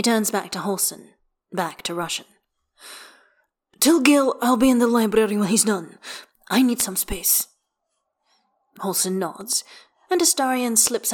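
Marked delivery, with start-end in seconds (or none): 3.8-7.5 higher and louder, curt and tense; a clipped vampire lordling, fed up; Astarion's voice. Acted. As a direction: voice Astarion brushing them off sharply. Astarion speaks to Halsin.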